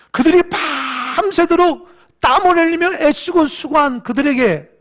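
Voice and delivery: loud, impassioned sermon climb, stretched slow on 풍성한; preaching at full force. Acted. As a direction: preach it with a catch of tears in the voice. (열정적으로 외치는 설교)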